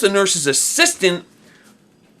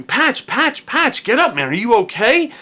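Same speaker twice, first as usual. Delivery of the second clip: mock urgent friend calling his name, raised higher; a friend shaking you awake, pleading; worried friend; 6 semitones higher than usual.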